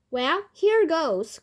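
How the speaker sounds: slow, bold exclamation; hearty cry. A narrator's reading. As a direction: call it out before taking the plunge. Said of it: "cried Grandpa George".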